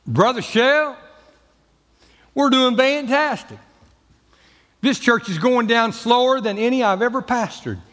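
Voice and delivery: high, bright-voiced mock pastor, pleased with himself; imitating cheerful pastor. Pastor answering Brother Shell, high and cheery. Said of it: "I asked him how his church".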